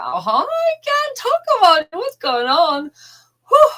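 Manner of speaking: barely holding back tears; overcome with emotion. Real, not acted. Vlogger overwhelmed talking about the pilgrimage, choked-up high voice. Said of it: "I'm actually feeling very emotional".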